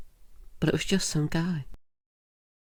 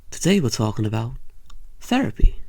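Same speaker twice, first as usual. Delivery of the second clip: hosty announcement; 2 semitones lower, 4 dB louder than usual. Acted. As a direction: sell the topic like a game show host. Talk show host opener.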